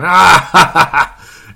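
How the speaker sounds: loud, high burst of put-on laughter; mock guffaw. Podcaster imitating a couple guys in a picture, mock guffaw. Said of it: "couple guys going".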